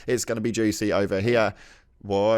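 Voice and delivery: normal talking voice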